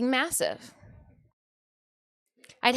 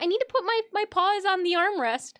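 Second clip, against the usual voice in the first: lightly raised pretend cat voice; voicing the cat; 5 semitones higher, 6 dB louder, faster than usual. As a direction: speak for the cat, a bit higher. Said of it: "He's like".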